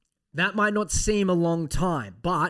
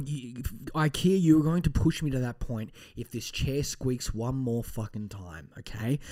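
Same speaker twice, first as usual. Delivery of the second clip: ordinary talk, nothing marked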